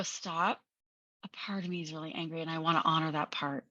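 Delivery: acted soothing inner voice, low and soft; calm self-talk. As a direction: settle the anger quietly and kindly. Calm self-talk answering the outburst.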